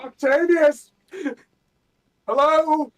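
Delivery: high, loud panicked calls; shouting. Acted. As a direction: yell out for a missing ally. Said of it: "like I'm shouting to one of my comrades".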